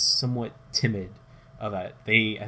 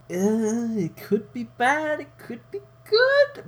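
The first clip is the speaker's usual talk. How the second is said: squeaky unsure voice, much higher; nervous high voice; 5 dB louder, slower than usual. Retold or imitated. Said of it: "They're like".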